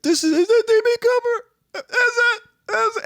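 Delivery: very high, squealing excited questions. Retold or imitated. Realizing the note is D B Cooper, squealing with excitement. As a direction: squeak it out like a thrilled fan. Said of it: "I was like".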